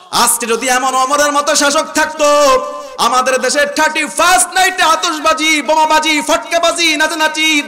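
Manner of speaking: roaring rally cry from the pulpit; full-force preaching. Real, not acted. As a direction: rouse the crowd at the top of your voice.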